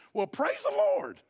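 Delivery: high, drawn-out burst of praise; jubilant praise. Retold or imitated. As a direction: exclaim it high and slow, in startling contrast to the gloom. Pastor's startling joyful reply to confession.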